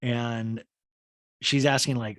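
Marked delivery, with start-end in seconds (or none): none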